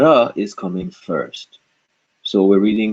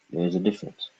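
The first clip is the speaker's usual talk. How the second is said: whisper; 10 dB quieter, faster than usual. Real, not acted. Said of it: "aside after pronunciation contrast".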